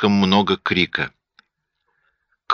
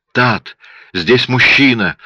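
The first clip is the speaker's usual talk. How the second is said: moaning; 6 semitones higher, 3 dB louder, slower than usual. A narrator's reading. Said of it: "простонала она в телефон".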